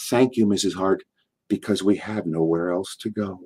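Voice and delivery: soft, acted humility; a humble little thank-you, hammed gently; mock humble. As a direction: play it meek, trailing down low. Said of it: "I humbly hung my head and I said".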